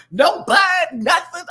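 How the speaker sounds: high, loud outraged squeal. Retold or imitated. Outrage at sister being overlooked, high squeal. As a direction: shriek it like you can't believe it.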